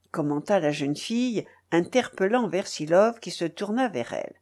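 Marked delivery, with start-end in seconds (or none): none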